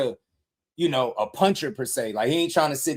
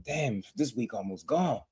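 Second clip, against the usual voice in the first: ordinary talk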